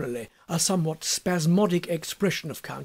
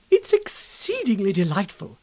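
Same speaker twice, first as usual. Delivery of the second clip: delighted; 2 semitones higher than usual. A narrator's reading. Amelia delighted by the news.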